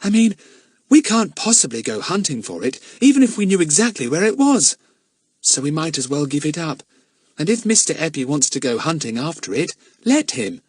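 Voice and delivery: put-on a small girl talking her friends out of it; timid girl. A narrator's reading.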